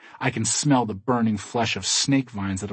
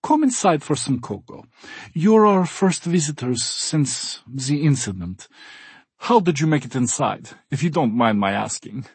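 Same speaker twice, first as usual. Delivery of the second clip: put-on cheerful German accent; 4 semitones higher than usual. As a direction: welcome guests warmly, German accent. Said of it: "Gunther says".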